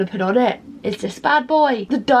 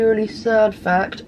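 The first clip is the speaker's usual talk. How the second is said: normal talking voice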